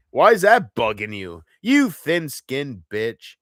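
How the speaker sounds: ordinary talk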